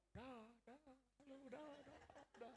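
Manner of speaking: high, soft, put-on goodbyes; Manado belle. Pretty Manado girl greeting everyone, high mock voice. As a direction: toss off high little bye-byes. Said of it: "pilih yang Manado".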